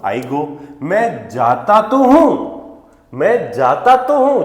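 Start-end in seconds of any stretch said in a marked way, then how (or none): 0.8-2.3 तो in "मैं जाता तो हूं" said slowly and leaned on; slow, weighted तो before हूं; stress on तो. Shown as a demonstration. Teacher showing emphasis to students, leaning on तो. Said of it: "यहाँ पे हम जोर देते हैं". 3.1-4.4 "मैं जाता तो हूं" higher, with तो hit and falling; slow, pitched-up तो as the emphasis point; stress on तो. Shown as a demonstration. Teacher demonstrating the emphatic sentence, stressing तो. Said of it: "यहाँ पे एम्फेसाइज कर रहे हैं".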